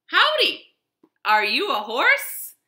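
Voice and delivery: high, loud friendly howdy; cheerful cowboy greeting. Acted. Cowboy greeting a wagon, high and loud. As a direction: call out like an eager young cowboy. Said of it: "said the cactus".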